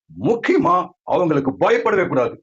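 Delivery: ordinary talk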